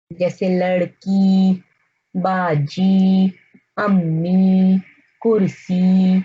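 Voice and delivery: slow, stretching the long ई at the end of लड़की. Shown as a demonstration. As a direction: draw out the final ई in लड़की and बाजी. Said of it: "तो ये ई की आवाज देती है". (धीरे, आखिर की ई लंबी खींचकर)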